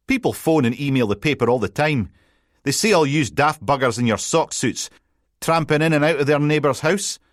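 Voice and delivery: a light cheeky Scottish reporter voice; cocky journalist. Acted. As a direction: needle the detective with a grin. Colin showing off his scoop.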